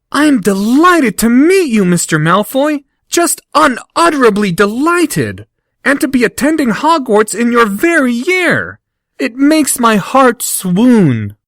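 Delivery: high, gushing fawning voice; fawning flattery. Acted. Harry gushing over Malfoy, fawning and high. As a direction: lay the flattery on thick.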